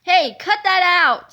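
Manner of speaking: loud, annoyed yell; loud frustrated villain yell; frustrated scream. A narrator's reading. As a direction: shout at the pests to stop. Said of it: "screamed the frustrated fiend".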